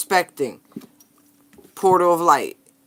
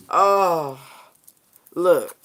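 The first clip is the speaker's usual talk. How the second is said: ordinary delivery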